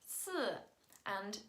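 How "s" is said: s as a held Mandarin syllable; s sound. Shown as a demonstration. Teacher introducing tongue twister sounds, isolated s. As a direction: hold the hissed s on its own. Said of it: "uses the sounds s".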